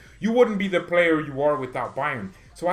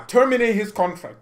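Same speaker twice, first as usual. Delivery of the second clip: shouted, higher and louder; furious demand; 8 semitones higher, 7 dB louder than usual. Acted. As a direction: bark the order at the club. Angry fan ranting.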